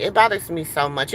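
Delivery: normal talking voice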